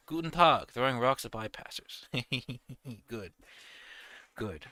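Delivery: ordinary talk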